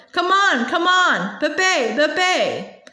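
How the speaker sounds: mild, high-pitched cheering on; crowd yelling. A narrator's reading. Spectators on their benches cheering the bay, mild yelling. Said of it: "up on their benches yelling".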